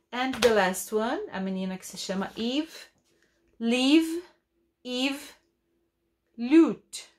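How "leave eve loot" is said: "Leave" "Eve" "loot" raised and slow, each set apart; teacher modeling words, higher. Shown as a demonstration. Teacher models leave eve loot higher for the class. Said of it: "teacher models words".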